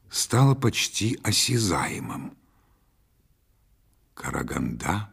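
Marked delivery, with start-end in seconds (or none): 4.1-5.1 hushed, low question; rustling whisper. A narrator's reading. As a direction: ask it very softly, pitched down. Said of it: "прошелестел Меладзе". (тихий, шелестящий вопрос)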